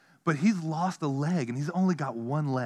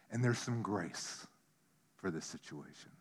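quiet; 8 semitones lower, 12 dB quieter than usual. Real, not acted. Sermon point on grace.

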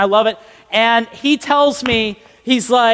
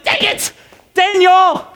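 exasperated cry; 8 semitones higher than usual. Acted. Tempted listener blaming Daniel's voice, exasperated high cry. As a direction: call the name out high in mock annoyance.

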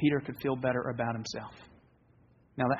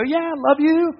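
casual reply; 14 semitones higher, 4 dB louder than usual. Retold or imitated. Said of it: "And I go".

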